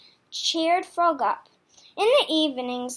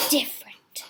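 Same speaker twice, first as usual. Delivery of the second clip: whispered. A narrator's reading. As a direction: let the word hang in a whisper. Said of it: "whispered word".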